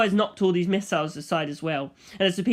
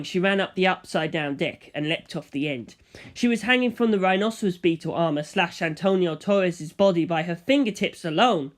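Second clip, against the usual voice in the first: plain reading voice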